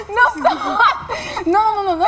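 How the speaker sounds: high, loud protest through laughter; laughing protest. Real, not acted. Teased about speaking pidgin, laughing and protesting loudly. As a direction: wave them off while still laughing.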